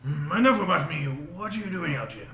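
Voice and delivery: ordinary delivery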